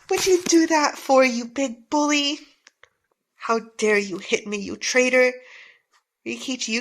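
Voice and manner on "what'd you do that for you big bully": loud furious yell at the bully; angry shout. Acted. Girl yells at the bully who smashed the clogs, furious. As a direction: scream it at him, outraged.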